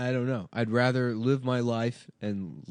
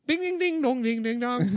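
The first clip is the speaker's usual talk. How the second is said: much higher sung-out ding dong noises; imitated crash dings; 4 dB louder than usual. Comedian doing the crash sounds of Chinese Tony Soprano. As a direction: chime it out like a silly cartoon crash. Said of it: "car crash noises".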